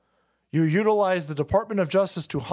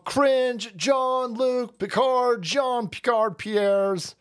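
mock drawl; 8 semitones higher, much slower than usual.

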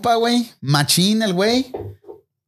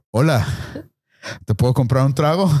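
deep-voiced stranger; 5 semitones lower than usual. Bearded stranger offering a drink, low voice. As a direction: drop low like a big bearded guy. Bearded guy hitting on him.

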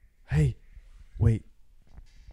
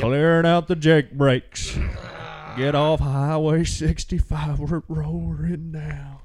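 loud, high, drawn-out holler; mock road holler; 5 semitones higher, 9 dB louder, much slower than usual. Friend clowning after a mic check, hollering. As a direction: bellow it slowly, stretching the words.

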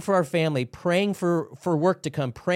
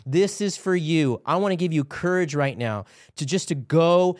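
raised, urgent preacher's exhortation; impassioned preaching; 4 semitones higher, 3 dB louder than usual. Real, not acted. Preacher urges men watching to seek God, impassioned. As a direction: preach it with building fervor, pitched up. Exhorts viewers, rising into prayer.

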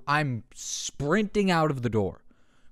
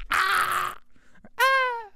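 put-on very high child's yelp; little kid's startled cry. A kid startled by a popping balloon, high yelping. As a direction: squeal like a scared six-year-old. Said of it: "we probably all went".

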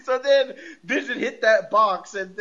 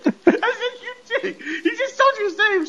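high-pitched, laughing through the words; laughing hard. Real, not acted. Recapping a magic act gag, laughing hard. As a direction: squeal it out through a fit of laughter.

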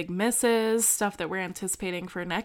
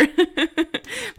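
high rising giggle; a bright little laugh; kind gentle laugh; 11 semitones higher, 6 dB louder than usual. Real, not acted. Laughing at dogs playing.

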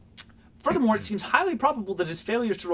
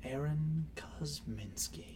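soft, slow calm name; hushed mock-serene delivery; 2 semitones lower, 13 dB quieter, much slower than usual. Real, not acted. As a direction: force yourself calm. Sudden calm after being scolded.

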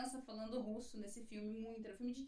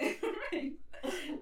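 high burst of laughter; laughing; 7 semitones higher, 4 dB louder than usual. Real, not acted. Guest laughs with hosts over the English post, high laugh. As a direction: let out a quick, high laugh.

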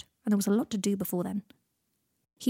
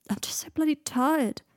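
higher, slow, worn-out lament; weary sigh; 7 semitones higher, slower than usual. A narrator's reading. Dying Draco admitting exhaustion to his friend, with a weary sigh. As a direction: drag it out, exhausted. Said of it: "He sighed".

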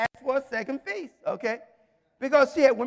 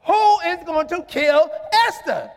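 drawn-out, indignant demand; raised voice, stretching the last question; outraged king; 3 semitones higher, 5 dB louder, slower than usual. Acted. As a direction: act a king aghast for his beloved. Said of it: "He was so mad".

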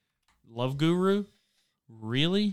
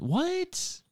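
surprised; 4 dB quieter than usual. Real, not acted. High disbelief.

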